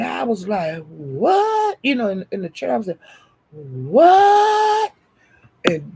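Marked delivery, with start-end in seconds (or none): 0.9-1.8 very high, drawn-out squeal of disbelief; hammed-up stunned reaction; shocked what. Acting out reaction in chat. 3.5-4.9 loud, very high, long-held shriek; hammed-up squeal of disbelief; shocked what. Acting out reaction in chat.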